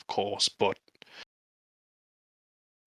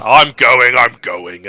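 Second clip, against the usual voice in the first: louder, huffing I'm going as he storms off; mock huffy exit; 3 semitones higher, 12 dB louder, slower than usual. The orc storms out past the priest, loud huffy grumble. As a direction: grumble it loudly while stomping away. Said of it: "and then I just stomp out".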